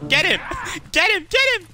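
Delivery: building excited shout. Real, not acted. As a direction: pitch climbs with each cheer. Rising hype shouts.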